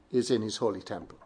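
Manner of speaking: "is in his holy temple" dropped low and soft after the stressed subject; unstressed remainder. Shown as a demonstration. Preacher finishing an emphasis demo, "is in his holy temple" low and soft. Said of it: "put an emphasis on different words".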